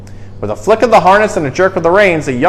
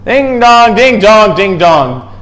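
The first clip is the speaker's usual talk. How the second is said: bell chime; 5 semitones higher, 4 dB louder, slower than usual. A narrator's reading. Church bells heard in blizzard.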